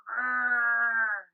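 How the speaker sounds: loud growl with the tongue out; yoga teacher leading the kids' lion growl. Shown as a demonstration. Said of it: "like a lion with his claws".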